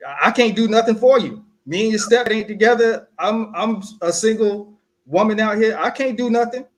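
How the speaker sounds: mock mom voice. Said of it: "what she's telling me is".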